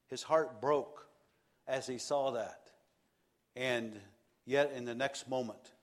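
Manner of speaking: usual speaking voice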